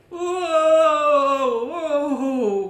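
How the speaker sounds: loud high startled whoa; old farmer crying out as he tumbles. A narrator's reading. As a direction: yell as you fall backward. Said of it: "cried old Tom".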